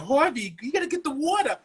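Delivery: mock Brooklyn nag to her husband; wife calling out in a put-on Brooklyn accent. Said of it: "she was like".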